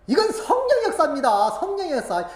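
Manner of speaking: loud, high fervent preaching; full-force sermon cry. Real, not acted. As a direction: proclaim it to the congregation.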